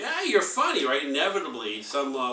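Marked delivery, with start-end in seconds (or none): 0.0-0.9 people teasing. Retold or imitated. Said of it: "when people start to say".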